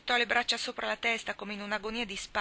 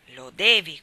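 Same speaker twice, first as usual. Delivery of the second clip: sharp loud order; commanding; 13 dB louder, slower than usual. Acted. Boy commanding his sister to burn her dolls, loud order. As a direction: bark the order, no arguing. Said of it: "comandò Demi".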